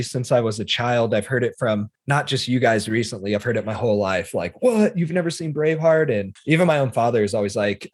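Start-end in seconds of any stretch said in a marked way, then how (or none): 4.6-6.1 higher-pitched imitated astonishment; incredulous quoted question, pitched up; mock disbelief. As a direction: imitate someone shocked at a friend's confession. Imitating people shocked he never saw it.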